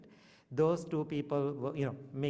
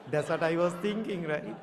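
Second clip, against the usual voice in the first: higher, louder, laughing admission; 4 semitones higher, 5 dB louder than usual. Real, not acted. Admitting to the congregation he hoped nobody returned, laughing. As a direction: own up with a laugh.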